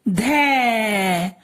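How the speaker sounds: धै held long, gliding down; drawn-out syllable. Shown as a demonstration. Teacher answering for the child, drawn-out धै. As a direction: stretch the vowel of धै. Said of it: "तो क्या बोलेंगे?".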